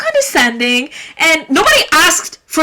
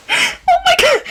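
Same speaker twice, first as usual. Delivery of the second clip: high laugh into oh my god. Real, not acted. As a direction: laugh it off after ranting. Laughing after the rant.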